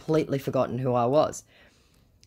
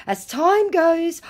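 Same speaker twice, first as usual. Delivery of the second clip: mock scammer voice; 6 dB louder, much slower than usual. Scammer Tom voiced in retelling.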